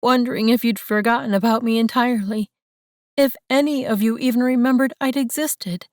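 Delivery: fast, high, loud through sobs; crying. Acted. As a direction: spill it out between sobs. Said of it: "she hiccuped the words".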